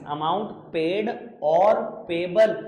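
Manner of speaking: very slow, word by word for writing; slow dictation. Shown as a demonstration. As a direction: dictate each word so they can copy it. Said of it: "dictating the wording". (धीरे-धीरे लिखवाना)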